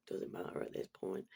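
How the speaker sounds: breathy whisper, dropping the thought; whispered aside. Real, not acted. Cutting herself off mid-story, a low whispered aside. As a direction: throw it away in a whisper.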